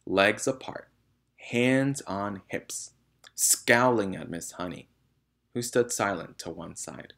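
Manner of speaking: ordinary delivery, nothing marked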